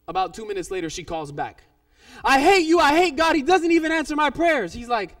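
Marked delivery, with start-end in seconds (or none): 2.2-4.7 high, loud retold tirade; angry outburst. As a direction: burst in mid-rage, acting her out. She calls back in outburst.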